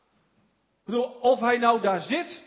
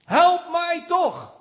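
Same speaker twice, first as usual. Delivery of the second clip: slow, higher and louder cry for help; a blind beggar shouting for help, half acted; mild mock shout; 5 semitones higher, 4 dB louder, much slower than usual. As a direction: stretch out a desperate plea. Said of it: "Schreeuwt om hulp".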